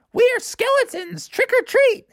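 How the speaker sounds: put-on high kids at the door; little kid voice. A narrator's reading. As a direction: chirp it like a small kid.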